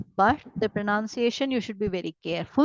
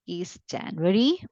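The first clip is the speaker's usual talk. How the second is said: usual speaking voice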